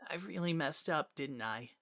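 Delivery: put-on a frightened little boy barely speaking; weak small child. Azriel blames himself.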